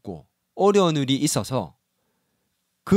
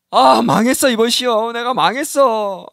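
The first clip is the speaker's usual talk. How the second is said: mock wail; 6 semitones higher, 5 dB louder, slower than usual. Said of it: "현수 아니겠지?".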